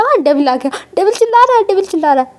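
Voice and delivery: startled shout, pitch jumping high; waking up yelling. Acted. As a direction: jolt awake and shout about the devil. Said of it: "CC चिल्लाते हुए उठती है".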